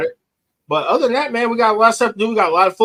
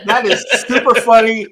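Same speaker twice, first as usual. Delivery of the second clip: amused, louder, through laughter; laughing; 3 semitones higher, 3 dB louder than usual. Real, not acted. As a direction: chuckle as you protest. Amused by co-host's risky joke.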